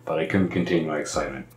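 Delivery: ordinary talk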